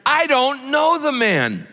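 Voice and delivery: high, drawn-out shout of denial. A narrator's reading. Peter loses his temper at his accusers, shouted denial. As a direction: shout it slowly, pitch way up. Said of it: "And he shouted".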